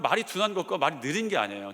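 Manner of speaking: normal talking voice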